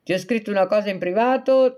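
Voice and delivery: ordinary talk, nothing marked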